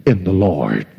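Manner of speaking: ordinary talk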